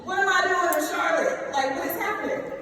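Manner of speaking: high, incredulous questions; disbelief. Retold or imitated. Youngstown kid wondering at Charlotte, high and incredulous. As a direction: sound amazed at where life put you. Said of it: "kid from Youngstown in Charlotte".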